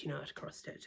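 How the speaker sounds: whispered "you know how to cross stitch"; an offhand whispered reassurance. Real, not acted. Repeated phrase whispered.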